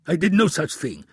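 accused prince denies, nervous; nervous denial. A narrator's reading. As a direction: deny it too fast. Omar denies.

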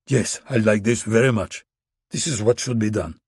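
put-on Russian accent. A narrator's reading. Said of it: "His accent was thick but understandable".

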